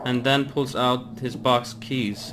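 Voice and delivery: hushed, measured note reading; reading a note aloud. A narrator's reading. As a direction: read it out carefully and softly. Reads a note aloud in game.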